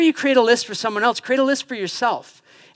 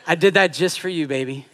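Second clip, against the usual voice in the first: playful tease; 6 semitones lower than usual. Retold or imitated. Joking reply to wife.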